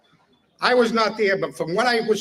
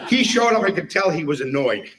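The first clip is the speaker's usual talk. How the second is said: normal talking voice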